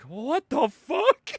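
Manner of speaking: slow, drawn-out, high-pitched bafflement; mock-stunned, incredulous question; baffled disbelief. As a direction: stretch every word in stunned disbelief. Batman's baffled reaction, retold.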